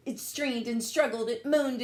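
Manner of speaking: ordinary delivery, nothing marked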